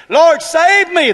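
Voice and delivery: high, loud, slowly stretched plea; hammed-up cry to the Lord; mock plea. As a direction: parody a lazy prayer. Mocking a shallow prayer.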